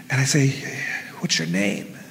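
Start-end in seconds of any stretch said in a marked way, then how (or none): 1.2-2.0 soft, low, careful voice; hushed, low-pitched and careful; gentle question. Retold or imitated. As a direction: ask it very quietly to someone in distress. Gently asking distressed woman.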